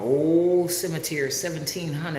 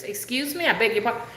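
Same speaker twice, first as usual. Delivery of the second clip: puzzled listener; 6 semitones higher, faster than usual. Retold or imitated. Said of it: "he couldn't understand".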